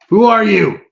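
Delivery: high, loud goblin challenge; goblin calling out. Acted. Goblin on the wall challenging the stranger below, high loud call. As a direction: yell down from above, suspicious. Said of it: "call out".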